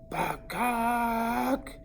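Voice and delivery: high, squawking rooster bakak; rooster call. Retold or imitated. The rooster dares the boys, squawking high. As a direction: crow it out like a cocky rooster.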